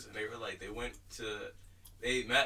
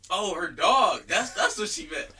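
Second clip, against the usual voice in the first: loud, high "oh" of understanding; light up as it clicks; sudden realization; 6 semitones higher, 12 dB louder than usual. Retold or imitated. Dad realizing the lady meant her dog, loud relief.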